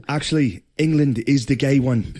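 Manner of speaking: mock-indignant Irish brogue; Irish accent. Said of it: "do it in an Irish accent".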